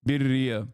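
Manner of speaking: "Birria" with a tapped R; copying chat's birria. Shown as a demonstration. Vlogger echoes chat's version. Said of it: "Oh, okay".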